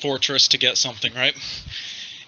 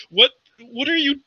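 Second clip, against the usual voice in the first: high, halting questions; flustered, pitched-up disbelief; bewildered guard; 10 semitones higher than usual. Acted. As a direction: stammer in baffled shock. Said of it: "And he's like".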